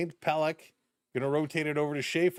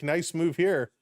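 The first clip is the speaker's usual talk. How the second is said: ordinary talk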